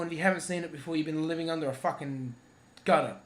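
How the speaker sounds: ordinary delivery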